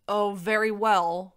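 slow, loud, grudging dragon; grumpy. A narrator's reading. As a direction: give in with bad grace. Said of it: "Warog said grumpily".

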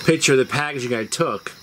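usual speaking voice

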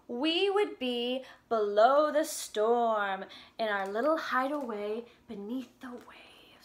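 slow, sing-song storybook reading; reading to children. Acted. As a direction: read the lyrics gently to little kids. Reads song lyrics to children.

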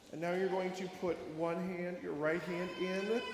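ordinary talk, nothing marked

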